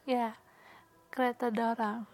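ordinary talk, nothing marked

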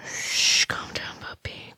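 very soft hushing whisper; soothing whisper. Acted. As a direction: hush them under your breath. Hushes the struggling listener.

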